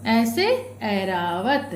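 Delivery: ऐसे Airavat read very slowly, word by word; slow, deliberate reading for young pupils; slow dictation reading. Shown as a demonstration. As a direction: stretch out each word so the children can follow. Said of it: "slow reading demo for children".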